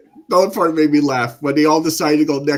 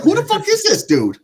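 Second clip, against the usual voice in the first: high, startled who-is-this; baffled outburst. Retold or imitated. Friend reacting to a strange character, high and incredulous. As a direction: jump up in pitch in disbelief. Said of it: "I'm like".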